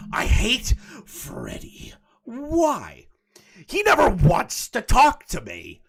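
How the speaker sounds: high, loud mock sobbing read; dramatic wail. Streamer reading the ex's message aloud in a mock cry. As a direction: ham up his complaint with a cry.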